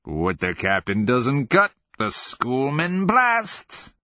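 slow singsong climbing high at the end; crowing taunt. A narrator's reading. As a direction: draw it out into a high crow. Cackling character crows his warning.

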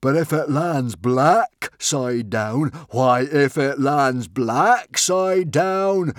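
loud, slow, building to a soaring shriek; mad yell. Acted. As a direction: play the crowd then explode on the last words. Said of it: "yelled Norbert the Nutjob".

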